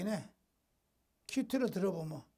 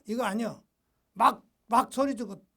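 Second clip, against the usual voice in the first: normal talking voice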